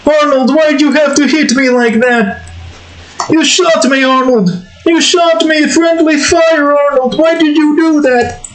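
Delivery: very high whining character voice, protesting; Oscar. Acted. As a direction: complain to a friend in a high cartoon voice. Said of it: "it's supposed to sound like Oscar".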